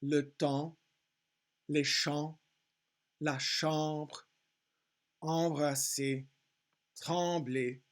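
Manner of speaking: "Le temps, les champs, la chambre" in careful French; French word list, spaced out; same nasal sound. Shown as a demonstration. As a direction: give "temps, champs, chambre, embrasser, trembler" one nasal AN vowel. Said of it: "the same exact sound can be spelled".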